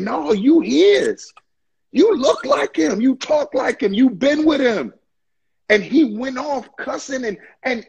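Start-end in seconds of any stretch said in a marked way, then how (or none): 0.0-4.9 higher accusing voice; the crowd accusing Peter, higher and insistent. Retold or imitated. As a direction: press the charge like an accuser. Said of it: "they kept saying".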